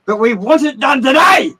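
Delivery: high, loud mocking shout of their demands. Mocking the family, a sudden high loud shout. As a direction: yell it like the bullying family.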